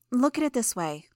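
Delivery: a calm teammate talking you down; light Genesis voice. Acted.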